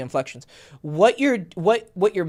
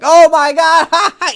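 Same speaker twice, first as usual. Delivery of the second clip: much higher, louder laughing burst; loud laughing exclaim; 12 semitones higher, 10 dB louder than usual. Shown as a demonstration. Coaching a friend on range, loud laughing outburst. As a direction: demo a big reaction to a funny clip. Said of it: "being really loud and laughing".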